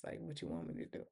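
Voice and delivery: breathless whisper, fast. Retold or imitated. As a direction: whisper it like a scared secret. Danny refuses to snitch, whispered.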